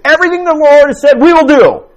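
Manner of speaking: high, loud, fast mocking of the people; mocking Israel's overconfident promise, high and loud; mock eager promise. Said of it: "They said".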